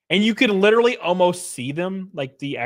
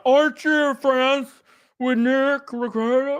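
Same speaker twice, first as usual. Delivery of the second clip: much higher, slow, dopey mocking voice; mock clueless commenter; 9 semitones higher, slower than usual. Streamer parodying a commenter, high and slow. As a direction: stretch it out like a dim commenter. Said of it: "Somebody comments down".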